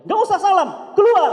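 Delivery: loud, high furious yell; angry shout. Retold or imitated. Father refusing the greeting, shouting him out. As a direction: roar him out of the house.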